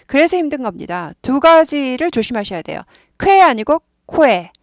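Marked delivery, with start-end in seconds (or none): 3.2-4.5 "쾌" against "코에", louder and slower; 힘주어 쾌와 코에 비교; emphatic pron contrast. Shown as a demonstration. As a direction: punch the contrast "쾌" versus "코에". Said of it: "코에도 아니고 꾸에 이렇게 돼요".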